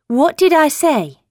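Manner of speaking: high, loud schoolmarm rebuke; prim schoolmarm. Acted. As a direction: scold him like a strict teacher. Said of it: "Her voice was like a schoolmarm's".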